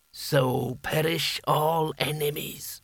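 very slow, solemn villain pronouncement; the hat's voice. A narrator's reading. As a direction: intone it as a triumphant magical hat. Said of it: "he said in the hat's voice".

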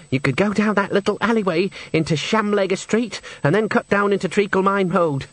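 lightly played singer reminiscing; lightly voiced singer Henry. A narrator's reading. Henry explains the third way out to Nanny, lightly voiced character. Singer gives directions in dialogue.

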